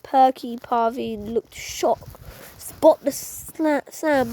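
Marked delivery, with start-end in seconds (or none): none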